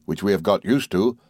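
ordinary delivery

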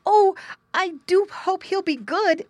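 put-on high, loud boyish hope; excited exclamation. A narrator's reading. Boy hoping for pet pig, exclaiming. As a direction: exclaim it with eager longing. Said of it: "exclaimed the boy".

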